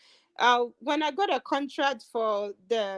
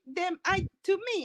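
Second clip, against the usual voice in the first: normal talking voice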